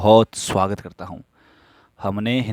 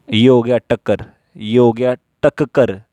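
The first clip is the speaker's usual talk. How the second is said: normal talking voice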